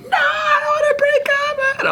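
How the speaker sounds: put-on high wailing sob of a girlfriend who won't break up; mock crying shout. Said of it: "she's just like crying".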